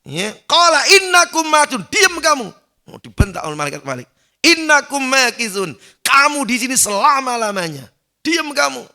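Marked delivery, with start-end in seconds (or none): 0.4-2.5 much higher, louder scolding shout; angel's harsh bark. Acted. 4.4-8.8 much higher, louder scolding shout; angel's harsh bark. Acted. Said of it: "dibentak ... suara yang menggelegar".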